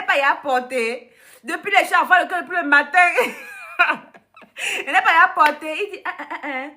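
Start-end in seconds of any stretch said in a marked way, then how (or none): 3.0-4.0 short squeal of laughter, falling; very high squealing laugh. Real, not acted. Laughing at Jesus's answer, squealing high. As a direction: let a squeal of laughter escape.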